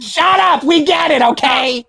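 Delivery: high, loud mock outburst at a friend; teasing shout. Co-host teasingly shouting down his friend, mock loud. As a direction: playfully yell him down, half laughing. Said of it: "I'm just teasing you".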